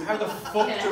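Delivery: usual speaking voice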